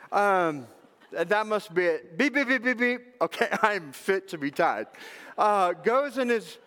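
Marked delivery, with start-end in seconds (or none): 2.2-3.0 high run of mimicked beeps; be the scanner alarm sounding; imitated detector beeps.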